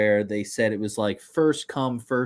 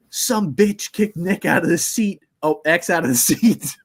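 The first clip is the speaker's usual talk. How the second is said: angry dad; 5 semitones higher, 4 dB louder, slower than usual. Retold or imitated. Dad voiced, angry at lady.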